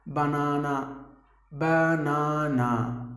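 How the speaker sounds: BANANA as ba-naa-naa with open AH; drawn-out, evenly weighted syllables in BANANA; common wrong pronunciation. Shown as a demonstration. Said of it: "Usually people say it as".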